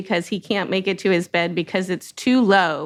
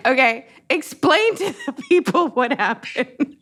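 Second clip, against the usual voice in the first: usual speaking voice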